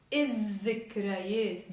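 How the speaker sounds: الذكريات as iz-zikrayet, doubled Z; silent lam. Shown as a demonstration. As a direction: leave out the L in الذكريات. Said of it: "we shouldn't pronounce the lam here".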